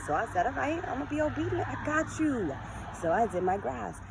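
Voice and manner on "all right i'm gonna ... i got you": playful. Retold or imitated. Answering God playfully.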